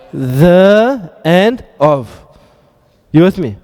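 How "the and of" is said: The and of drawn out very slowly, each word spaced; slow stressed answer. Shown as a demonstration. Teacher spelling out the answer to students, slow and deliberate. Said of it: "What must you add?".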